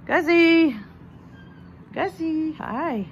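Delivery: light up calling the pet; excited greeting. Real, not acted. Greeting the pig.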